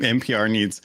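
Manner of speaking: usual speaking voice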